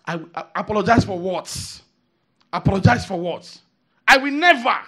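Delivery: loud indignant mock retort; proud refusal. Preacher imitating a prideful man scoffing at apology, loud.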